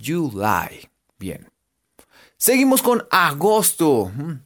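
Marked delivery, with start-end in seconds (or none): 0.0-0.9 July said "yu-lai", J as a Y; July ending in "ay". Shown as a demonstration. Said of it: "la terminación sería I". (July con final en "ay")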